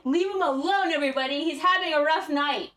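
hammed-up defensive shout; protective outburst. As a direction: call out to the crowd, pleading his case. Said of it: "tempted to be like".

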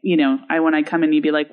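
ordinary talk, nothing marked

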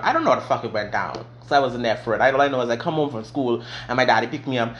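usual speaking voice

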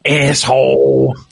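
mock wife cursing, slow and drawn out; drawled asshole at another driver; imitating wife. Wife cursing at the driver who cut her off. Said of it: "she would always go".

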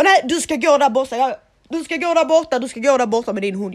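put-on high, loud, bossy old man's bark; grumpy old man. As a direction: shout him down like a cranky old neighbour. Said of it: "Han bara". (sur gubbe som skäller högt)

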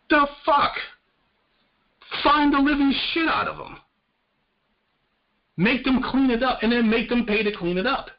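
outraged. Real, not acted. Ranting to Blue about the spill, outraged and high-pitched. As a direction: let the outrage burst out, pitch way up.

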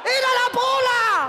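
high, loud, slow echo; stretched comic cry; shocked wail. Acted. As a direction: wail the reveal in drawn-out horror. Teller echoes the shock.

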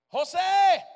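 high, loud calling out; shouted name. Retold or imitated. Man shouting a random name in the mall, loud call. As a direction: yell the name across a crowd. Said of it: "lo voy a gritar".

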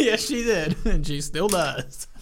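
talking through real laughter; laughing agreement. Real, not acted. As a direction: tease along, words breaking into laughs. Joking along through laughter.

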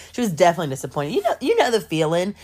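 ordinary talk, nothing marked

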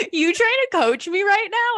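laughing mock indignation. Mimicking friend's indignant reaction.

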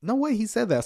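as if you can't believe your ears; shocked disbelief. Retold or imitated. Hearing the pastor's line, a shocked high "no way". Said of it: "I was just like".

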